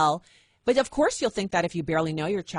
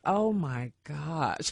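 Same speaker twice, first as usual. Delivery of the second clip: embarrassed son; 4 semitones lower, 4 dB quieter, much slower than usual. Retold or imitated. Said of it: "He's like".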